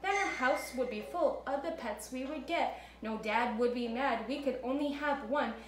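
put-on bouncy storybook rhythm for kids; lively read-aloud for children. A narrator's reading. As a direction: read it like story time for little ones. Reads Seuss story to kids.

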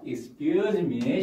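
"Excuse me" said slowly with the pitch falling at the end; 내리는 억양; falling tune. Shown as a demonstration. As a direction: let "Excuse me" drop down at the end as a polite opener. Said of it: "내려가면은 실례합니다".

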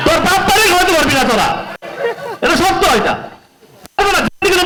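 fast taunt broken by laughs; scornful laughing. Real, not acted. Actor mocking the YouTubers, quick laughing scorn. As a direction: laugh them off while jeering.

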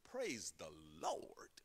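a slow, breathless whisper; whispered praise. Real, not acted. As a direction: drop to a hush of awe. Hushed praise after climax.

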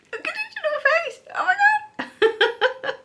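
very high squeaky coo; overwhelmed by cuteness. Real, not acted. Gushing over crochet toy.

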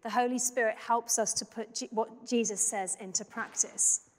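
ordinary talk

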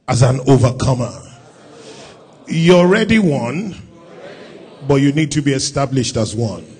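loud, fast, driving pulpit delivery; preacher building to a loud peak; full force preaching. Acted. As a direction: rouse the congregation.

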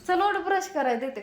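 high, loud call; calling son. Real, not acted. Mother calls son to brush his teeth, loud and high. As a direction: get him moving.

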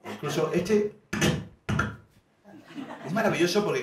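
ordinary talk

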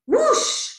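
high, loud, swooping whoosh; whoosh of wind. A narrator's reading. Wind gusts as Mole opens the door, loud whoosh. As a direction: swoop up high like a sudden gust.

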